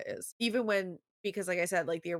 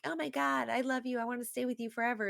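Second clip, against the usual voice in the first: put-on high, rushed teen swooning; mock lovesick gushing; 7 semitones higher than usual. Mocking a girl's undying love, gushing. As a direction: gush like a smitten romance heroine. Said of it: "it's not like".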